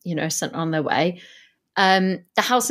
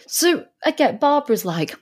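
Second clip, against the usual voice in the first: ordinary delivery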